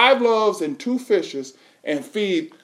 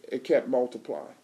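hushed emphasis; 8 semitones lower, 11 dB quieter than usual. Real, not acted. Preacher drops voice for effect.

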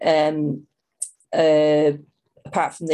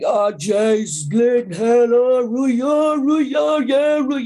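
loud, rising rant of a boisterous uncle; impression of Andy; 5 semitones higher, 5 dB louder, much slower than usual. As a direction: ham up an uncle's noisy exclamations, climbing higher. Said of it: "But Andy was like".

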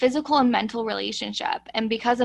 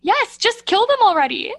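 much higher, loud glee; excited; 11 semitones higher, 9 dB louder than usual. Real, not acted. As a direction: cheer it out gleefully. Gleeful answer confirming guess.

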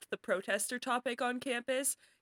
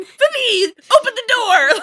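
hammed-up cop yelling, much higher and louder; mock cop shout; 13 semitones higher, 16 dB louder, slower than usual. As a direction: bellow it like a raiding officer. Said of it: "could you imagine them going".

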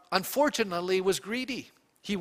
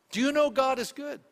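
rising preacher emphasis; higher, emphatic repeat; 7 semitones higher than usual. Shown as a demonstration. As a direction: Do you know God is good asked again higher, pressing the point. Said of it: "repeated question".

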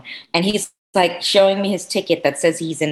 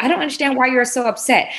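higher condescending whine; mock entitled passenger, higher; 7 semitones higher than usual. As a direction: imitate a smug seat-stealer. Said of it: "They're like".

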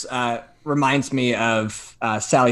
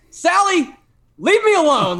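high, loud yell at someone; retold yelling; 13 semitones higher, 7 dB louder than usual. Telling off the woman at the doorbell, yelling. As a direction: shout it out as a warning-off, pitched right up. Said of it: "I start yelling at her".

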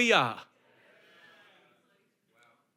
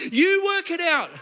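higher, mock fed-up retort; acted exasperation; 5 semitones higher than usual. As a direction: throw it back at God in playful protest. Moses' retort to God repeated.

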